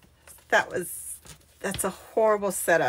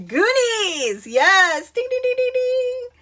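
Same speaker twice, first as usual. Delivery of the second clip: excited cheer; 5 dB louder than usual. Real, not acted. Host cheering a viewer who guessed the movie, excited cheer. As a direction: celebrate like a game-show winner bell.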